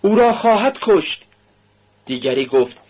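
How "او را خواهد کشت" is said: high-pitched cry of alarm; alarmed shouting. A narrator's reading. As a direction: cry out high like a shocked onlooker. (فریاد زیر و هراسان)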